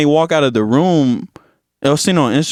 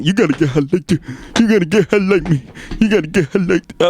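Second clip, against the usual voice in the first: odd put-on rap voice; mimicked rapper voice; 3 semitones higher than usual. Mocking the new songs to a friend, put-on rapper voice. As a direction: parody a rapper's quirky delivery.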